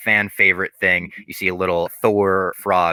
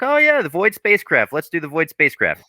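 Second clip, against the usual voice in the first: mock sweet Southern Miss Minutes; 8 semitones higher than usual. Host mimics the sly cartoon clock luring Sylvie, in sweet Southern tones. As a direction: coax them in with a syrupy Southern voice. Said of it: "She has that sweet Southern accent".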